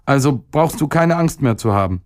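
put-on high-pitched, mildly sulky child voice; sullen boy. A narrator's reading. Boy dismissing the stomach's worry, mildly sullen. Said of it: "brummte der Junge verdrießlich".

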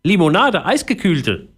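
high parrot order, falling at the end of each word. A narrator's reading. Parrot orders a drink, high demanding voice. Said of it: "sagte der Papagei".

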